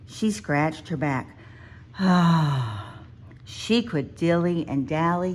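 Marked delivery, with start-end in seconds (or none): 2.0-3.0 relieved ah. A narrator's reading. Bear scratching her back.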